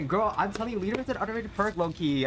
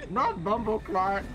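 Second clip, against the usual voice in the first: put-on Jamaican accent; 5 semitones higher, slower than usual. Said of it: "Don't say that".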